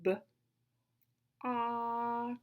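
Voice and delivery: short "B", pause, long held "ox"; super slow sounds. Shown as a demonstration. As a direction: sound out "B, ox" very slowly, vowel stretched. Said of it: "Listen again for my sounds".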